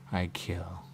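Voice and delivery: softly whispered; whispered admission. Real, not acted. Admitting that he kills, in a whisper. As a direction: barely breathe the confession.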